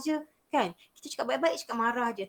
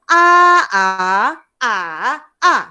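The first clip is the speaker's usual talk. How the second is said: A level, A rising, A dipping, A falling; four A's, each with its own tone; four tones in turn; 12 dB louder than usual. Shown as a demonstration. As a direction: step A through all four Mandarin tones. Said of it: "tone yang pertama, kedua, ketiga, keempat".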